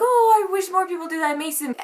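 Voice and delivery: high, gushing imitation of grateful people; grateful admirers. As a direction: gush thanks, starting loud and high. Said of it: "basically thanking me and saying".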